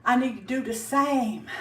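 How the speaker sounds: ordinary talk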